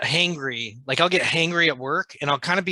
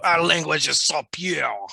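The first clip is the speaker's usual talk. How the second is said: a hint of a haughty French accent; lightly put-on French accent, mocking. French co-host mocking French purists in a light fake French accent. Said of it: "making fun of how French people can't speak English".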